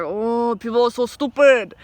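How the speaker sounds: lightly imitated Indian accent; bad Indian accent. Boss shakes head at forecourt customers, in a mock Indian accent. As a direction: grumble in a put-on Indian accent. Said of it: "horrible Indian accent".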